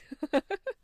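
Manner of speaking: loud high-pitched giggle. Acted. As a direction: giggle loudly, embarrassed. Giggle while voicing scene.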